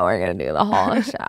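low creaky fry, a bit quick; joking vocal fry, voice dropped low. Pretend. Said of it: "I was gonna talk like that".

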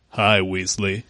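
low, soft femme fatale purr; seductive purr. Acted. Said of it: "he purred seductively".

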